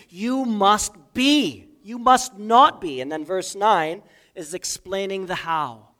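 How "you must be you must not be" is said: "You must be" and "You must not be" slow, high and loud; emphatic reading. Shown as a demonstration. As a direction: lean hard on "must be" and "must not be". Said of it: "linking it to the verb".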